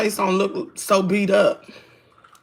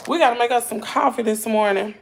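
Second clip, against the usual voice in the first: usual speaking voice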